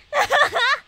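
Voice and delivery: sudden high laugh; burst of laughter. Real, not acted. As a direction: laugh it out loud. Embarrassed by otaku comments. (risa fuerte)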